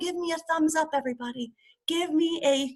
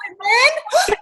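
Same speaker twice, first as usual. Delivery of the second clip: ordinary talk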